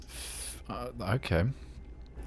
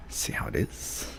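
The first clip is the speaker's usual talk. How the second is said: whisper; 12 semitones lower, 10 dB quieter than usual. Acted. Mock-offended aside.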